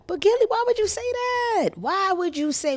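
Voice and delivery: a wailed, incredulous why; exasperated questioning. Pretend. Host questioning Gilly over his remark, exasperated.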